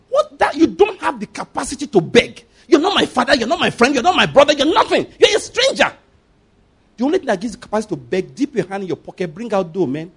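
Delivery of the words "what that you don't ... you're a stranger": high-pitched acted outrage; indignant rebuke. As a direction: rebuff the meddler, pitch leaping up. Acts out rebuking a meddling beggar.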